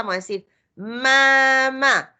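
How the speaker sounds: "mamá" drawn out slowly; blending. Shown as a demonstration. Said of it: "si juntamos las dos letras". ("mamá" unido y lento)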